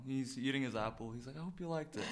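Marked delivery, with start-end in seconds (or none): 1.4-2.0 softly imitated, unbothered comic; a quiet, casual retelling of his modest greeting, slightly higher; calm. Comedian eating his apple as he greets a fan, calm and soft. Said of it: "He's really calm".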